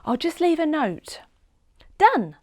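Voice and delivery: put-on girl leaving a note for home; young girl. A narrator's reading. Seb voiced by narrator.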